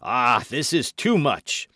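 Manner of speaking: slow, loud exclamation; indignant cry. A narrator's reading. Buckingham has heard enough from Felton, indignant cry. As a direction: cry out, drawn out and outraged. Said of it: "cried Buckingham".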